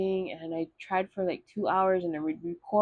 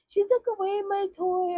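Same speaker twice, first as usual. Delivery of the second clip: put-on much higher whiny child's tattle; tattling little kid; 14 semitones higher than usual. Little sister tattling to mom on older sister, whiny high kid voice. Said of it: "And so I'd be like".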